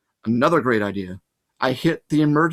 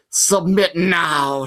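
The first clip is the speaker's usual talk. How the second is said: slow, louder monster hiss; hissing Fury; 3 semitones higher, 4 dB louder, much slower than usual. A narrator's reading. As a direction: threaten like a demon, dragging it out. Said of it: "she hissed".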